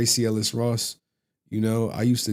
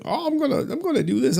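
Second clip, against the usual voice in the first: mock high excited voice; 3 dB louder than usual.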